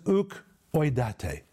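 "Uk oidate" said slowly and carefully as Greek; Greek pronunciation. Shown as a demonstration. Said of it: "The Greek says".

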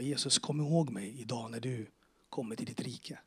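hushed whispered prayer; whispered humble request to Jesus; whispered plea. Retold or imitated. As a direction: breathe the plea like a secret.

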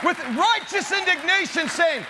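rally the congregation to fight; thundering sermon build to a shouted peak; fiery preaching. Acted. Preaching at full force.

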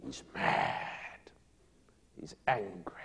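hushed, breathy whisper. Real, not acted. Preacher telling the congregation of Absalom's rage, whispered. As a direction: let it drop to a secret whisper.

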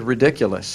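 ridiculous sounded out syllable by syllable; ridiculous with a clear "you" in the middle; correct pronunciation. Shown as a demonstration. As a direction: say ridiculous as "ri-dick-you-lus". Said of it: "I pronounced it correctly".

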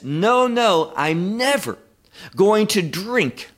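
firm, drawn-out refusal stressed on never; emphatic never. Acted. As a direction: lean into no, no and never. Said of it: "I'll never do it until".

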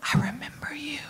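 soft unvoiced whisper. God whispering over Ruth, a soft whisper. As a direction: breathe it tenderly, barely a sound. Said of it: "God whispers over Ruth".

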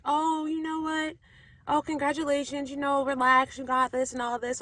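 high-pitched congratulating helper, imitated; encouraging assistant.

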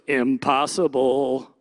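low, slow put-on naysayer; deep little voice of doubt in the head; doubting inner voice. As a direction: draw it out deep and dismissive. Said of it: "this little voice goes".

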